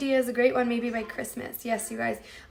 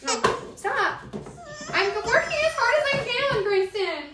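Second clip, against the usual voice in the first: exasperated parent; 11 semitones higher than usual. Real, not acted. Pleading with demanding child while busy.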